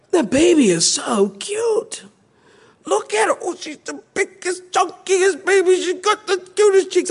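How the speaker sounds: put-on very high cooing baby talk; gushing over baby. Pastor coos at newborn, high gushing baby talk. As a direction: coo over the chunky baby. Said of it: "Oh, and everything".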